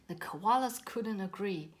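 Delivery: plain reading voice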